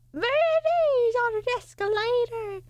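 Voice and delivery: high, slow silly voice. Pretend. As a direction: draw it out in a goofy high voice. Silly voiced non sequitur.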